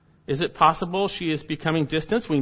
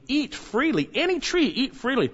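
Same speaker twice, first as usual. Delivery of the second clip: high-pitched stress on "eat freely" and "any tree"; emphatic command; 7 semitones higher than usual. Shown as a demonstration. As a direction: lift "Any tree" high to press the point. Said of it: "It's emphatic in God's command".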